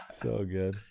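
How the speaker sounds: said through a laugh; chuckling it out. Real, not acted. Laughing at the exchange.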